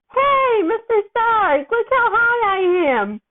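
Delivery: put-on a loopy kid showing off from the ceiling; giddy excitement. A narrator's reading. Drugged teen thrilled on ceiling.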